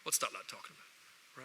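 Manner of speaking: mock muttered aside. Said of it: "you're sentient going".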